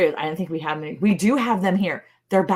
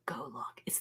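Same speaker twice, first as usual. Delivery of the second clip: whispering; 6 semitones lower, 20 dB quieter than usual. Real, not acted. Whispered aside to viewers.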